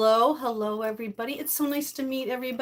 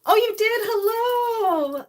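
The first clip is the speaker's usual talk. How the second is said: much higher, louder squealing hello; delighted squeal; 11 semitones higher, 6 dB louder, slower than usual. Real, not acted. Host spotting a favourite viewer, delighted squeal. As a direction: squeal with joy at a familiar name.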